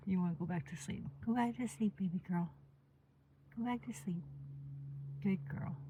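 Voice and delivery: soft, hushed coaxing to sleep. Real, not acted. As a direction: gently lull her back to sleep. Soothing dog back to sleep.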